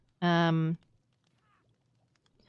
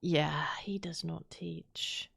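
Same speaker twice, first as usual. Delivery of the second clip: whispering; 6 dB quieter, much slower than usual. Real, not acted. Blunt criticism of a teacher.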